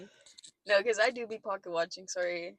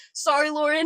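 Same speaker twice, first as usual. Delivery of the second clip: imitating a raised, louder call; shouted through a wall; 7 semitones higher, 7 dB louder than usual. Roommate apologizing to Lauren through the wall, imitated shout. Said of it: "shouts it through the".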